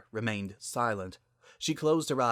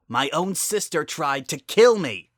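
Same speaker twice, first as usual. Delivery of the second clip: much louder angry shout; 6 semitones higher, 10 dB louder than usual. Acted. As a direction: bark it back. Accusing shout.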